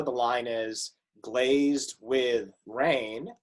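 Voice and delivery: "glazed with rain" slow, each beat set apart; teacher counting the beats of a line, slow; counting syllables. Shown as a demonstration. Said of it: "with the next stanza".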